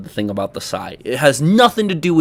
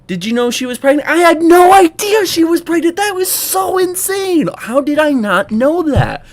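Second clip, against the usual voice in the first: mock disbelief; 13 semitones higher, 5 dB louder than usual. Sarcastic disbelief at obvious fact.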